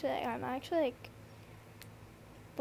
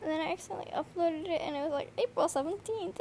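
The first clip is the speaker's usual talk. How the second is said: normal talking voice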